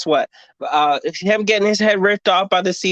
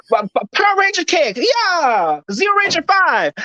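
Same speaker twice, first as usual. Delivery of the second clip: put-on much higher, excited fighting-girl cry; hammed-up battle cry; 9 semitones higher than usual. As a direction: shout it like a cartoon hero mid-kick. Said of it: "she said".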